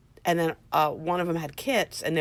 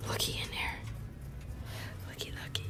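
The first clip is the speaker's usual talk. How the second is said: coaxing whisper; 14 dB quieter, much faster than usual. Real, not acted. Coaxing rabbit from nesting box.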